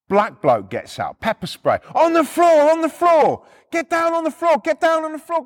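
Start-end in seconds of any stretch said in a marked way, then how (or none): 1.9-5.4 high, urgent mock shouting; shouted police order. Copper with pepper spray ordering repo man down, shouting. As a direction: shout commands like a wound-up officer. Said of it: "They're all fucking shouting at us".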